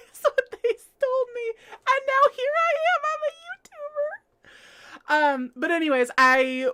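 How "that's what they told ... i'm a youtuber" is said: very high, squeaky gushing; high squeal. Real, not acted. Touched by full circle memory.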